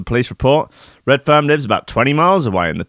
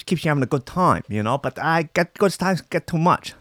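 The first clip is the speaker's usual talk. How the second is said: higher slowed-down silly voice; odd put-on voice; 5 semitones higher, 4 dB quieter than usual. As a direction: drift into a weird put-on voice for no reason. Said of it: "Don't know why I'm talking like that".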